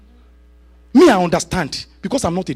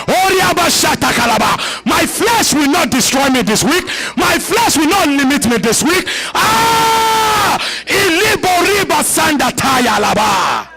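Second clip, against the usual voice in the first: fervent shouted prayer; 7 semitones higher, slower than usual. Acted. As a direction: storm heaven with every line.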